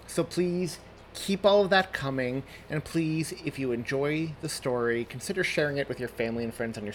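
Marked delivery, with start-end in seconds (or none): none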